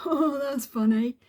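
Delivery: high, loud, slow mock-amused landlord voice, with a laugh; sneering send-up of his fake amusement. Mocking landlord Darren's dismissive reply.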